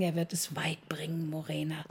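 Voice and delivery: soft, hushed whisper. Acted. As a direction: breathe it quietly to her. Whispering to Morena.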